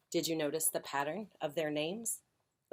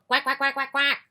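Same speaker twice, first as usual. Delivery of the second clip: high, frantic duck quacks; rapid string of high quacks; quacking ducks; 9 semitones higher, 11 dB louder than usual. Acted. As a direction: keep quacking over the traffic. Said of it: "kept right on quack".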